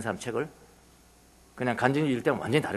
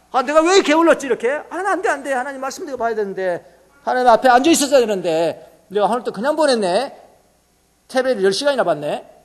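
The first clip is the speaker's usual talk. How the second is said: higher, louder, regretful self-scolding; self-reproach; 9 semitones higher, 6 dB louder than usual. Acted. Believer after a lazy day scolding himself, louder. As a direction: groan at your own wasted day.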